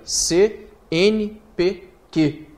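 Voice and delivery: slow "C N P Q", each letter said apart; letters one at a time, drawn out. Shown as a demonstration. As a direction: spell out C N P Q slowly. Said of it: "eu falei letra a letra".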